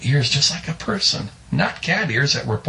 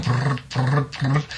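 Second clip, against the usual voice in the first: trilled "brrrt" sound effect; repeated vocal rattle of wild trees; imitated shaking trees; much slower than usual. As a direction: rattle out rolled bursts of shaking. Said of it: "the trees were still going".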